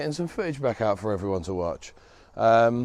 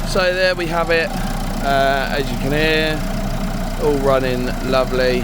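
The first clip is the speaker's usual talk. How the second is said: louder, talking over engine noise; raised voice; 2 semitones higher, 5 dB louder than usual. Real, not acted.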